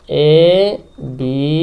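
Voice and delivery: very slow, drawn-out letters; spelling out while writing. Real, not acted. Teacher writes the rectangle's name, spelling out slowly. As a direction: stretch each letter as you write it.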